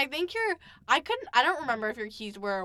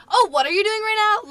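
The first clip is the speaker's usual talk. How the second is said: higher, louder mimicking of his nosy question; loud nosy Thomas; 10 semitones higher, 9 dB louder than usual. Imitating her friend barging in, loud and high. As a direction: act out a loud, clueless friend. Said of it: "So he's like".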